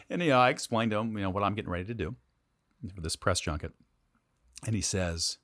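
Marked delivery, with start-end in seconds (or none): none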